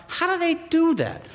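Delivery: high, wide-eyed wonder; amazed wonder. Retold or imitated. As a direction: ask it like you're stunned. Wondering aloud at illusion.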